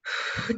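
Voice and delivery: real breathy sigh mid-sentence; taking a breath. Real, not acted. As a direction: pause and let out a heavy breath. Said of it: "have to take a breath".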